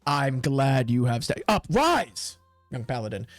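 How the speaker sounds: grand commander greeting a recruit; theatrical knightly welcome. Acted. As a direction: bid them rise with authority.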